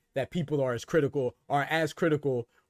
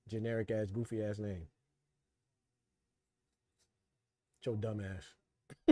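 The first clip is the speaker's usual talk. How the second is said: low, much softer, very slow put-down; muttered insult; 6 semitones lower, 12 dB quieter, slower than usual. Real, not acted. Streamer mocking viewer Jerry's name, low and soft. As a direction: murmur it under your breath.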